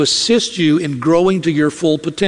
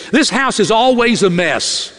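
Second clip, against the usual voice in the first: exasperated wife; 4 semitones higher than usual. Retold or imitated. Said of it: "A woman will say this".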